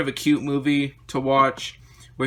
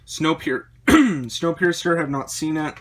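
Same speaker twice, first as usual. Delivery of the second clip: losing voice; 3 semitones higher, 4 dB louder, slower than usual. Real, not acted. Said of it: "Almost kinda sounds like I'm losing my voice here".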